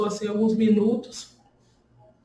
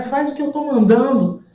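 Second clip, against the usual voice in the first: imitating a rude doctor, higher and harder; rude doctor's order; 4 semitones higher, 4 dB louder than usual. Doctor repeating his order to the woman in labor, louder mock bark. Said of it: "E ele super grosseiro".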